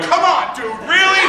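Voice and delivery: mock the father's pleading whine; exasperated dad. Friend protesting from the background in a high mock whine. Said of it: "her father in the background going".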